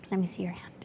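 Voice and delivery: whispered, soft and calm; hushed request. Retold or imitated. Father quietly asks the drunk man for his hand, whispering. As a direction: whisper it close, holding back anger. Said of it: "walks over to the gentleman".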